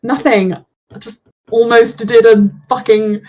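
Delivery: ordinary delivery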